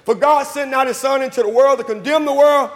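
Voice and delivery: ringing sermon delivery, stretched key words; full-force preaching. Acted. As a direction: preach it out to the whole church.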